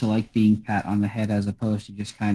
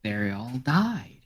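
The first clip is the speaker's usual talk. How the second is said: somber cat; 6 dB quieter, much slower than usual. Acted. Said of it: "looks at Gur and says".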